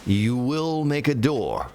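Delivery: slow, drawn-out mock Darth Vader; lightly hammed Vader, word by word. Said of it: "Darth Vader".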